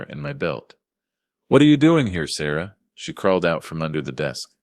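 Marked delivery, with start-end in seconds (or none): 1.5-2.8 sharp, loud, high demand; urgent demand. Acted. Father confronting Sarah, loud and urgent. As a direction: snap the question at her.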